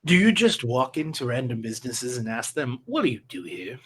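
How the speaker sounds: quiet, low deadpan undertaker; low, dry mortician. Acted. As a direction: speak low and flat, unimpressed.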